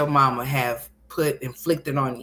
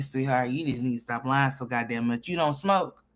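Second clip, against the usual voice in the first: usual speaking voice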